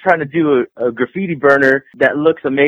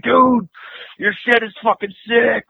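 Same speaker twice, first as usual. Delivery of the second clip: high-pitched hyped-up fan praise; excited fan; 11 semitones higher than usual. Retold or imitated. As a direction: gush like an impressed dude, pitched up. Quoting excited fans.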